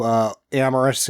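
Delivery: usual speaking voice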